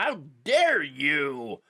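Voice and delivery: slow, drawn-out mock offense; hammed-up "How dare you?" with a long drawn "dare"; mock indignation. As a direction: stretch it out like a pearl-clutching liberal. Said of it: "get all upset and be like".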